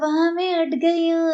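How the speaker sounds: mock cramped whine. Imitating the vlogger stuck in her tiny room, slow and whiny. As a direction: drag it out like she barely fits the room. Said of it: "ऐसे।".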